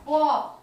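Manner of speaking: quick loud पॉप in a word review; punched pop. Shown as a demonstration. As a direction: pop it out like a bursting balloon. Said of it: "फिर है पॉप".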